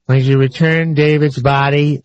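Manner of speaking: flat, emotionless imitation; calm deadpan plea. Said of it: "in her deadpan voice".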